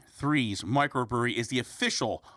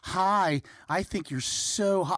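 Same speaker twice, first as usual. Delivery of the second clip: higher-pitched, drawn-out flirty voice; mock ridiculous female; 7 semitones higher, slower than usual. Woman in a viral car ad flirting, hammed-up. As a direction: imitate an over-the-top flirting woman. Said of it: "some ridiculous, like, female".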